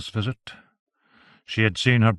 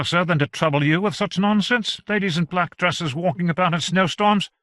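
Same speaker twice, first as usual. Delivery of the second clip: a higher servant's voice, dismissive; lightly voiced servant, scoffing; 7 semitones higher than usual. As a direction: scoff at the nonsense, a touch higher. Jeremy scoffs at the story.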